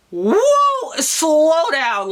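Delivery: high, loud startled whoa; shocked at the thought of a seventh child; alarmed whoa. Retold or imitated. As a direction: yelp it like a scare just hit you. Thought she was pregnant, scared.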